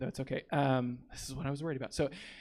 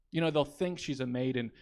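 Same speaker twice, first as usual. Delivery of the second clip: normal talking voice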